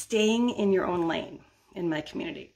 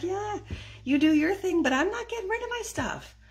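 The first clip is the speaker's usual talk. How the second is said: much higher mocking husband voice; wife mocking her husband's refusal in a high voice; mimicking husband; 12 semitones higher than usual. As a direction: parody him brushing her off.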